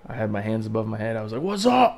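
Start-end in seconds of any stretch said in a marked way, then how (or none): 1.4-2.0 high, loud yell across a parking lot; retold shouted challenge. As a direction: call someone out, hyped up. Re-enacts challenge, hands up.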